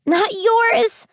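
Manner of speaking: high scream, voice breaking. A narrator's reading. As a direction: scream it, voice cracking. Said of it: "I scream, my voice breaking".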